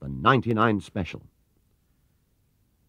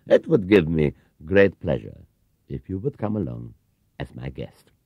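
a hint of a gentle old gentleman; lightly voiced kindly shopkeeper; 3 semitones lower, 5 dB louder than usual. Mr Gruber inviting Paddington along, kindly. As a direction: kind and unhurried, offering a treat.

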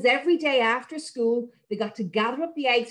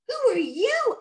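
put-on higher wondering boy voice; curious boy; 12 semitones higher, slower than usual. Tom asking the tiny creature who it is. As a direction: ask in amazement.

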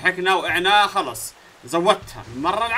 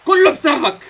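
much higher, louder yell; angry blame; 9 semitones higher, 11 dB louder than usual. Real, not acted. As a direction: snap at the character. Yelling at SpongeBob character.

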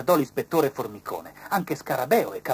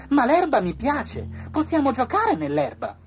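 butterfly's voice; 14 semitones higher, 7 dB louder than usual. A narrator's reading. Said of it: "rispose la farfalla".

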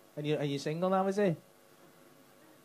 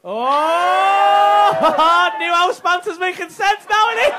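loud, high burst of delighted shock; real whooping laughing shout; surprised shout; 9 semitones higher, 13 dB louder, much slower than usual. Real, not acted. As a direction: yell it out as the penny drops. Shocked outburst at her answer.

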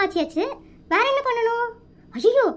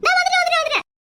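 higher, rapid repeated reply; hurried calling back; 4 semitones higher, faster than usual. Acted. Wife answering waiting husband, hurried and high. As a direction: rattle off the answer on a high note.

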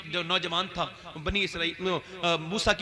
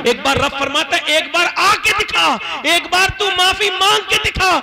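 high, loud impassioned sermon voice; full-force preaching; 7 semitones higher, 13 dB louder than usual. Acted. (پرجوش بلند آواز میں خطاب)

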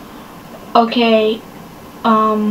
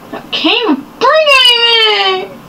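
whiny cry; 9 semitones higher than usual. Real, not acted. Stuck, can't carry items.

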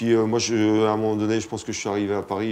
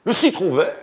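infomercial; 8 semitones higher, 4 dB louder than usual. Pretend. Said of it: "présentateur de téléachat".

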